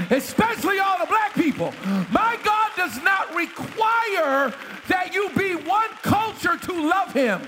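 fiery pulpit preaching; preaching at full force. Acted. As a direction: preach it out to the congregation. Preacher building to a shout.

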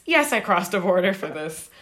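retold firm answer in an everyday pitch; matter-of-fact retort. Tattooed fan answering the shocked promoter, matter-of-fact. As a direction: answer the disbelief evenly, as if obvious.

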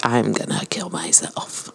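hushed whisper, no voice. Retold or imitated. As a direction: breathe it out unvoiced. Patients quoted, whispered.

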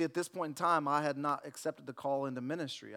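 ordinary talk

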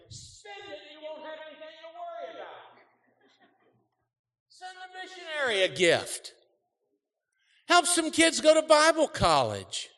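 very high, strained voice; high squeaky voice. Pretend. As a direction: squeak it out in falsetto. Voicing the punchline high.

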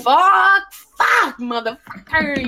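high, loud outbursts of swearing; hollered cussing. Retold or imitated. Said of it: "I'm hollering or screaming".

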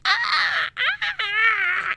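very high screeching cry; alien screech. Retold or imitated. Melting Terminator screeching as it dies, high alien screech. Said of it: "like, screeching".

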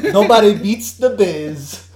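loud, slow hype promo; wrestling promo. Pretend. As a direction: hype it like a station ad. A mock radio promo.

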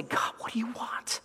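whispered, searching question to God; hushed prayer. Acted. Believer tuning all out to ask God, whispering. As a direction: ask it under your breath. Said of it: "Just tone it all out and say".

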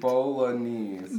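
slow, drawn-out Bolognese. Shown as a demonstration. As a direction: stretch out Bolognese slowly. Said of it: "you said it on slow".

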